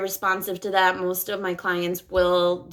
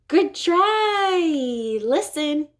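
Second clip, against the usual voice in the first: much higher, bright "Good try. Listen."; encouraging; 7 semitones higher, much slower than usual. Shown as a demonstration. Therapist coaxing a child to try again. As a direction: lift way up to coax the child.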